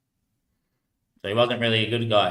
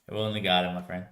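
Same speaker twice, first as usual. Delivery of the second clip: ordinary delivery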